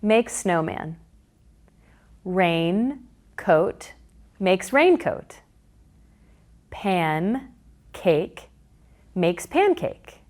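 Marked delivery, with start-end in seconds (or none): 2.2-3.9 Raincoat stretched into two parts; slow segmented. Shown as a demonstration. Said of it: "here's how the magnetic blocks are used for blending". 6.7-8.5 Pancake stretched into two parts; slow segmented. Shown as a demonstration. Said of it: "here's how the magnetic blocks are used for blending".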